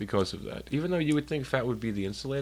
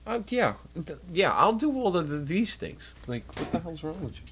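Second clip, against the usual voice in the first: mocking exasperation; 7 semitones higher than usual. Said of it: "I'm like".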